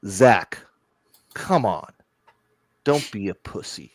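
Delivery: put-on low, gruff old man quote, slow at the end; a hint of a tough old artist; imitating Frank Miller. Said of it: "and I quote".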